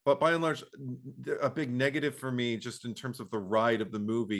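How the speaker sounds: usual speaking voice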